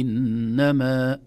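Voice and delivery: إنما loud and slow, drawn-out nasal ن; مد طبيعي. Shown as a demonstration. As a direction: hold the doubled ن of إنما, natural final vowel. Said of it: "يمد مدا طبيعيا". (إنما بغنة ومد طبيعي)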